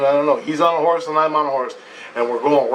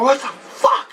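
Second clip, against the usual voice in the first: shocked disbelief; 8 semitones higher, slower than usual. Retold or imitated. Shocked at the vision figure.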